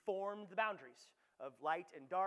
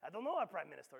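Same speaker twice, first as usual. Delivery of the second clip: bad French accent. French ambassador shrugging to the townsfolk, in a bad French accent. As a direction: play the clueless French ambassador with a weak French accent. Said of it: "I don't have a great French accent".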